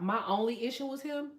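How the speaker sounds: normal talking voice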